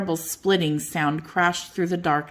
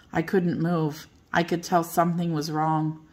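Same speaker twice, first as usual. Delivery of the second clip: ordinary delivery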